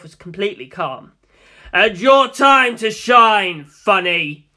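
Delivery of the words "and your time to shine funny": loud, slow showman's call; booming cue. Acted. Dio cueing Funny to act, grand booming call. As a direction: announce it grandly. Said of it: "At Dio's cue".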